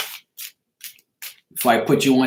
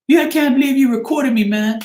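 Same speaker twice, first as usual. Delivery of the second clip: high-pitched mock protest; whiny, raised-pitch retold objection; indignant friend impression; 11 semitones higher, 4 dB louder than usual. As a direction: imitate a caught-out friend complaining. Retold friend caught out snoring.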